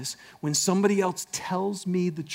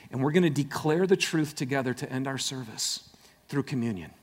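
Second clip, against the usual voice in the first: ordinary delivery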